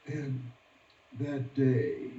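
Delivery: normal talking voice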